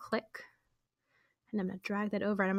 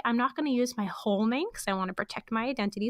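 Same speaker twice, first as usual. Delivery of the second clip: ordinary delivery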